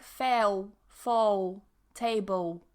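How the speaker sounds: "fell", "fall", "table" ending in a w sound; W for dark L. Shown as a demonstration. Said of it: "They say it as, like, a W sound".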